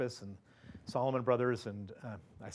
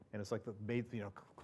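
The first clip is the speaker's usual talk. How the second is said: normal talking voice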